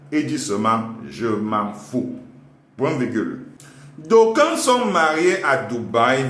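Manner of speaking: slow teacher dictation voice; dictating. Acted. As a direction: dictate it to the class. Dictating to class with punctuation.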